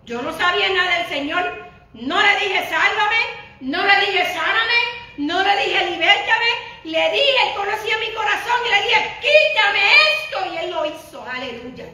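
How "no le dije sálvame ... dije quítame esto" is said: rising louder and higher to a loud high cry; building preaching. Acted. Pastor recalling her plea to the Lord, building to a loud cry. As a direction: build each line to the final plea.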